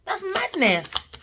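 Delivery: high, slow, stretched disbelief; incredulous. Real, not acted. Tarot reader repeating in disbelief, high and slow. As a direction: drag it out in disbelief. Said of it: "repeated, high and slow".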